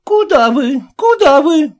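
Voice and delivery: high, loud cry of alarm; nanny shooing intruders away, alarmed. Acted. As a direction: stop him at the door in panic. Nanny stops merchant.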